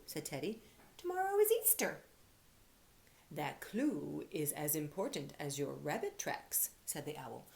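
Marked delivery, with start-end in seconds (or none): 1.0-2.0 high, bright and eager answer; excited Teddy. Acted. Teddy telling the owl it's Easter, eagerly. 3.3-6.7 owl voice. Acted. Owl character speaking.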